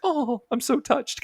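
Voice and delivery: high, slow, breathy swoon; mock touched. Leela's touched reply retold.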